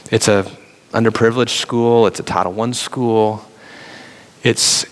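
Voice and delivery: normal talking voice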